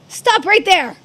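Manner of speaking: loud, high yell to halt her; shouting command. Acted. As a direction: bellow after someone running off. Pursuer shouts after fleeing girl.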